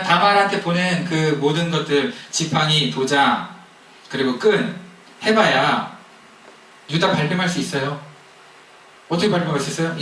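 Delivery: normal talking voice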